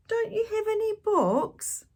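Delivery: put-on high, hopeful child's question; child asking. A narrator's reading. Boy asking the pirate captain for books, high pleading. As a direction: ask like a little boy. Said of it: "I asked".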